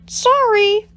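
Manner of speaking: high apologetic "Sorry"; a quick, squeaky little sorry; voiced character. A narrator's reading. Pig nine apologises.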